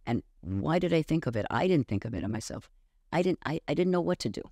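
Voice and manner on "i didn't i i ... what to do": usual speaking voice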